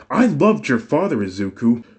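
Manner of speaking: mother shouting back at her son, frustrated. A narrator's reading. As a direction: snap back, hurt and defensive.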